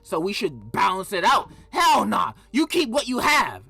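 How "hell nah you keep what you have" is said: higher and louder emphatic shout. Real, not acted. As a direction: belt it out in protest. Passionate shout.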